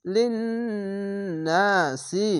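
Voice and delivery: লিন্নাসি with long humming nn; nasal ghunna. Shown as a demonstration. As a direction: hold the nn of লিন্নাসি through the nose. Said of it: "এখানে ওয়াজিব গুন্না". (লিন্নাসি গুন্না দিয়ে)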